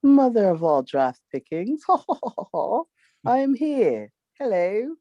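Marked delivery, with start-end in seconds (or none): none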